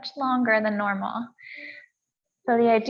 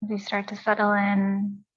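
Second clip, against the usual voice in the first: calm, measured yoga teacher's voice with long pauses; guided meditation. Real, not acted. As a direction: count the breaths evenly, leaving space between phrases. Leads breathing and meditation.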